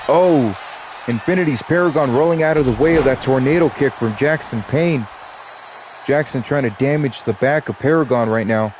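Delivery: lively ringside announcer calling the action; play-by-play commentary. Acted. Streamer calling the wrestling match for viewers, announcer style. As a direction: call the match like a wrestling commentator, stretching the names.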